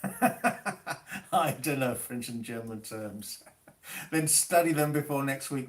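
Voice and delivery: talking through a real laugh. Real, not acted. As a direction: laugh while reading it out and replying. Amused reply to student's comment.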